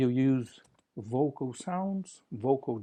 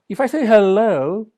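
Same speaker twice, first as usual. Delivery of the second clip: higher, louder, ringing hello; demonstrated hello; 8 semitones higher, 13 dB louder than usual. Shown as a demonstration. Teacher ringing out hello as a voice demo. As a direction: sing out the hello as a sample. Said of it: "I have consonants and vowels".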